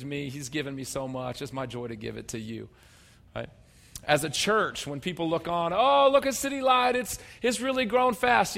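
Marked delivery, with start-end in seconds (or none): 5.7-8.6 higher, lightly voiced admiring bystander; mock gush about the church's growth; impressed onlooker. Preacher voicing a townsperson admiring the church, mildly excited.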